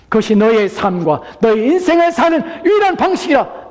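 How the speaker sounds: high, loud exhortation; full-force preaching. Acted. Preacher urging listeners to come to Christ, full-force preaching. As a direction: proclaim it urgently at the top of the voice.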